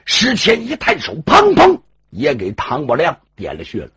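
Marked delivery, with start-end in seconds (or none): none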